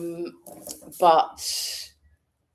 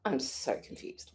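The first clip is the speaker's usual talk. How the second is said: a breathy aside, barely voiced; whispered confusion; 5 semitones lower, 13 dB quieter than usual. Real, not acted. Vlogger whispers her confusion over the schedule. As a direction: whisper it to yourself.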